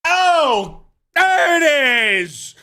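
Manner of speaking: high, loud gotcha; there it is, drawn out high and loud; triumphant. Real, not acted. Catching her buzzword, high loud gotcha.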